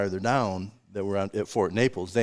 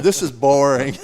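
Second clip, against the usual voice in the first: a mildly loud mock complaint; lightly voiced kid's whine, louder and higher; imitated bored kids; 4 semitones higher, 9 dB louder than usual. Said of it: "the kids are like, "We'll just wait".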